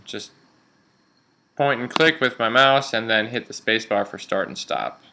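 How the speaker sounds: ordinary talk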